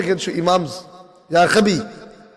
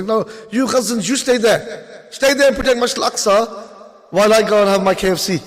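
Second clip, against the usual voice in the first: higher, sneering, dismissive mock voice; mocking other Muslims; 5 semitones higher than usual. Other Muslims telling Gazans to stay, mocked in a higher voice. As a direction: brush them off in a smug, whiny voice. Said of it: "all the other Muslims that say".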